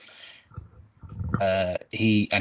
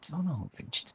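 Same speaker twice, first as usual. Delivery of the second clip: bewildered officials; 9 semitones higher than usual. Retold or imitated. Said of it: "they must have been thinking".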